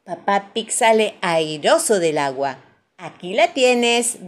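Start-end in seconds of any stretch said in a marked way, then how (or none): 3.0-4.3 slow, loud, pleased handover; self-satisfied dad, raised voice. A narrator's reading. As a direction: present it proudly, stretching the words. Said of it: "dice Papá Pig satisfecho".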